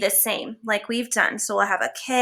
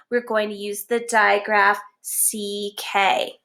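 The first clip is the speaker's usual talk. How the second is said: ordinary delivery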